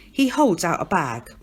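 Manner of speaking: ordinary delivery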